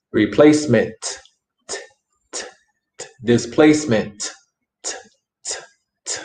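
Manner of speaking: "Replacement" and "Displacement" each trailed by isolated voiceless "t" taps; drum-like final t. Shown as a demonstration. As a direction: beat out the final "t" like a drum after each word. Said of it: "lleva un sonido como un tambor".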